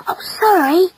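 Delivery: high, gasping apology; breath-caught boyish apology; gasped. Acted. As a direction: gasp it out, startled and flustered. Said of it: "he gasped".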